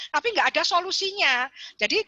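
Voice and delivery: normal talking voice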